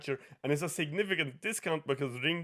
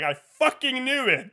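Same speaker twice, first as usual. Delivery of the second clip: loud, high mock outrage; retold angry vindication; 11 semitones higher, 6 dB louder than usual. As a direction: imitate someone furious at being proved right.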